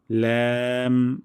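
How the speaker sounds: long ল্যাম, light ae vowel; thin lam. Shown as a demonstration. Teacher giving correct sound, long thin ল্যাম. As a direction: hold ল্যাম with tongue tip at upper gum. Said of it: "পাতলা সাউন্ডে".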